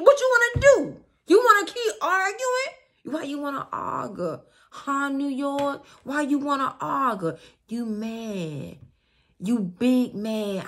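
high, loud, heated quarrel voice; hammed-up confrontational partner; dramatic querent. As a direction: play the partner picking a fight. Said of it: "my little two percent being dramatic".

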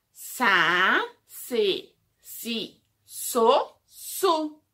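slow, loud teacher chant of each syllable; syllable drill. Shown as a demonstration. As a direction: spell out every syllable for small kids.

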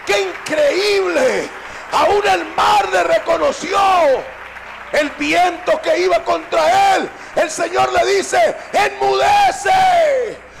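stretched, climactic pulpit shout; full-force preaching. Acted. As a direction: thunder it out to the congregation.

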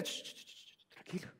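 unvoiced soft shushing; gentle hushing sounds; soothing shush. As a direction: hush a frightened child. Jesus hushing.

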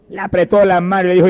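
usual speaking voice

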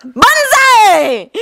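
high, drawn-out whoop; gleeful battle cry. Acted. Jun flings the cards skyward, whooping gleefully. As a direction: whoop it as you hurl something upward.

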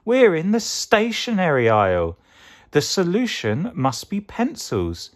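high, eager veggie voice; mildly excited, high-pitched realisation; excited discovery. Acted. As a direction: announce a find in a high voice. Tomato announces his discovery excitedly.

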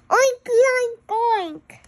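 loud, high piggy oinking; squealed pig greeting; pig oinks. Acted. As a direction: oink like a happy pig. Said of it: "That means Happy Pig Day in pig".

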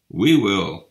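loud eager cry of the reindeer. A narrator's reading. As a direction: call it out loudly to be chosen. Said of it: "cried the reindeer".

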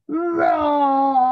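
hammed-up dinosaur roar for kids. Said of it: "Does a dinosaur go".

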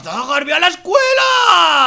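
loud, slow, high-pitched order; exasperated shout. Acted. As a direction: call it out across a distance, stretching the last word. Le grita al amigo desde lejos. (grito exasperado y alargado)

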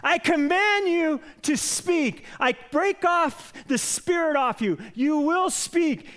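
high, loud, slow commanding shout; yelling prayer. Retold or imitated. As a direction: command the spirit out at full volume. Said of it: "'cause he's yelling".

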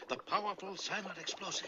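hushed narrator aside; whispered. Acted. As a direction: whisper it to the audience. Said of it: "Quiet, blabbermouth".

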